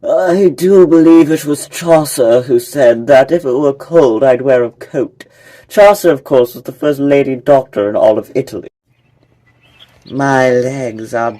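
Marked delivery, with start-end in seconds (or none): 0.0-8.7 louder, grand lecturing bird; pompous scholar bird. Acted. Pompous bird lecturing listeners about Chaucer, grand and loud. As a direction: boom it out like a self-important professor.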